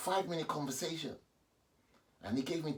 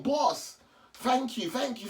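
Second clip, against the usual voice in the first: calling out; 10 semitones higher, 4 dB louder, slower than usual. Real, not acted. Calling thanks after him.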